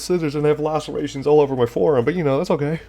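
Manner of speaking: nervous character voice. Acted. Character brushes off injury.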